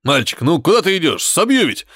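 put-on irritated grumble at a child; grumbling. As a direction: brush the kid off gruffly. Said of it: "ворчал высокий человек".

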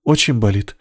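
usual speaking voice

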